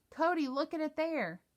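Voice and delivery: big brother showing the squirrel, excited; calling out. Acted. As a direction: point and call him over. Calling little brother over.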